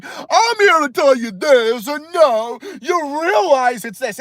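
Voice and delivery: high, drawn-out lecturing caricature; mock know-it-all expert. Streamer mimicking experts who act like they know everything, mocking high voice. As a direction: stretch the words out like a smug expert. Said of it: "experts that just act like they know everything".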